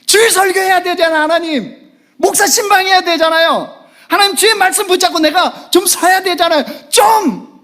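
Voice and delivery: high, loud pleading cry to God; loud, high exasperated prayer. Pretend. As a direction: beg God in Gyeongsang 좀 style. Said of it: "하나님한테 그러고 싶어요".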